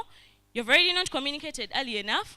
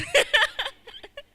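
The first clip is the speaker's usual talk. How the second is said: burst of laughter; 8 dB louder than usual. Real, not acted. Laughs at own rant.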